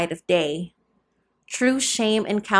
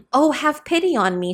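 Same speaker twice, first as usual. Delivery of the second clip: a raised, pleading cry for pity; begging; 6 semitones higher than usual. A narrator's reading. Frightened mother begging the soldiers for pity, mildly pleading. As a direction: plead from your knees, mildly. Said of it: "she begged, almost on her knees".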